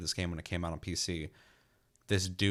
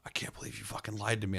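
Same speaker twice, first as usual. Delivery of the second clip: hushed whisper of betrayal; imitating a stunned whisper; whispered disbelief; 2 semitones higher, 4 dB quieter than usual. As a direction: whisper it like a hurt victim. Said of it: "they just like".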